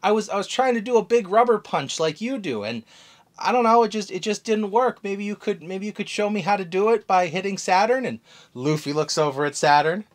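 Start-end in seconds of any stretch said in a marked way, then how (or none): 0.0-8.0 put-on higher, louder eager girl; lightly voiced girl asking for help; Bonnie voice. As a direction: Bonnie asking Luffy to show her the punch, eagerly. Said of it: "And she goes".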